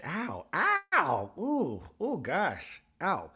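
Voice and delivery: imitated yelps of hurt ears; high ow sinking into slow moans; mock pain. Meredith reacting to whistle in her hearing aids, mock pain. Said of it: "blows her whistle".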